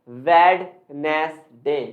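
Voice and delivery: "वेडनेसडे" slowly in three parts, ved-nes-day; common mispronunciation. Shown as a demonstration. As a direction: sound out the D in "वेडनेसडे", drawn out. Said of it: "आज तक आप क्या pronounce करते थे?". ("वेडनेसडे" धीरे-धीरे, वेड-नेस-डे)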